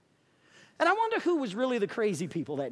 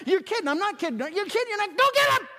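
insistent; 8 semitones higher, 3 dB louder, faster than usual. Retold or imitated. Charlie insisting, building.

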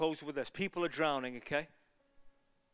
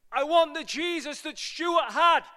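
high, slow, loud cry of longing; pleading shout; 10 semitones higher, 8 dB louder, slower than usual. Retold or imitated. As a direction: cry it out as a heartfelt plea. Quoting people's messages, preached.

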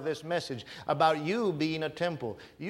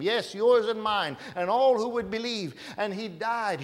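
raised, higher, driving preacher's voice; full-force preaching; 6 semitones higher, 5 dB louder than usual. Acted. Preacher tells church of their higher calling, preaching at full force. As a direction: press each point home, building in force.

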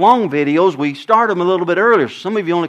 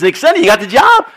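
mom asking; 4 semitones higher, 7 dB louder than usual. Retold or imitated. Mom asking yet again.

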